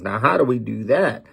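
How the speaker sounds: normal talking voice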